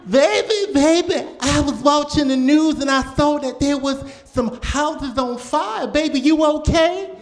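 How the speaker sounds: high, fretful grandma voice; an anxious old grandmother on the phone; mock worried grandmother. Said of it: "she thinks it affects me".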